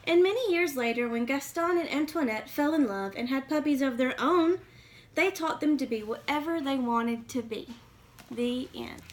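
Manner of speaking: put-on lively read-aloud for kids; storybook reading. A narrator's reading. Reading a picture book to young listeners, lively storytelling.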